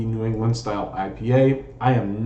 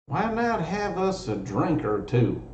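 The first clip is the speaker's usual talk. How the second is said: put-on voice; 7 semitones higher, 3 dB quieter, slower than usual. Jokey put-on toast voice.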